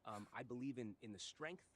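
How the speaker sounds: normal talking voice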